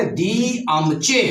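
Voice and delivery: दियमचे stretched slowly, both r's silent; English-style pronunciation. Shown as a demonstration. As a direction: say दियमचे slowly as one linked word. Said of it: "अंग्रेज ऐसे बोले".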